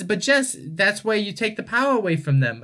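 mom explaining. Retold or imitated. Mom explaining herself to her child, imitated high-pitched insistence.

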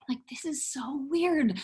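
slow, hushed disbelief; stage-whispered bewilderment; whispered aside. Retold or imitated. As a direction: whisper it to yourself, drawn out. Aside to herself about strange offer.

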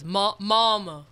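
hollered mama. Retold or imitated. Friends tease about a singer's holler, loud call. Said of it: "You have to holler this".

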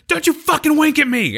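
high-pitched imitation of an angry yell; retold yelling. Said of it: "he starts, like, yelling".

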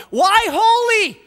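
high, loud, slow shouted question; thundering pulpit cry; preacher's shout. Acted. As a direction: shout it to the rafters. Same question shouted in climax.

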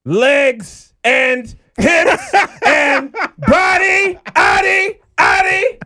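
high, loud, stretched hype yell; hyped shout. Acted. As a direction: belt it out like a hype man. Hyped guest intro shout.

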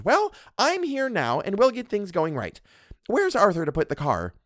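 a domineering wife scolding her husband; Mrs Montague. Acted. Mrs Montague lectures the doctor, haughty and bossy.

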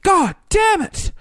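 drawn-out comic grumble; mock frustrated groan. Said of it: "and I was like".

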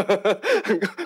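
quick burst of laughter; short laugh. Real, not acted. Laugh during discussion.

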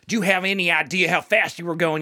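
lightly voiced stern trooper; a hint of a Louisiana cop; a trooper questioning a speeder, lightly played. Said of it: "I can't even do his accent".

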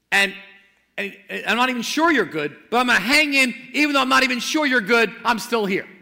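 loud, urgent preaching, rising high on key words; fervent preaching. Acted. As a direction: preach it out with full conviction. Preaching at full force.

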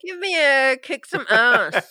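loud, hyped-up cheer; excited shout. Real, not acted. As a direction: pump everyone up. Hyped player calling for roll.

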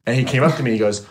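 ordinary talk, nothing marked

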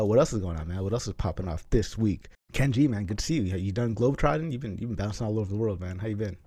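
ordinary delivery

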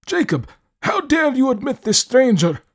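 put-on high, stern old man voice; scolding an old servant, outraged. A narrator's reading.

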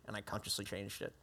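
ordinary delivery

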